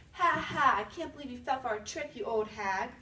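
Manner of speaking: put-on loud taunting laugh from the children; children taunting the witch in the oven, gloating; mocking kids. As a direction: jeer at her like a bratty kid. Kids mock the trapped witch.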